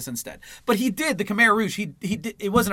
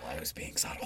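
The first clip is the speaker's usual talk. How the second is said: very soft, voiceless repeat; subtle whisper; 13 semitones lower, 15 dB quieter than usual. Pretend. Co-host defending his subtle sarcasm, whispered.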